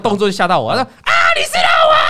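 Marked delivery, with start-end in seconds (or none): 1.0-2.0 high, loud shout of recognition; excited shout. Retold or imitated. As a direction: yell it out, thrilled to spot him. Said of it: "反应这么大啊". (又惊又喜地大喊)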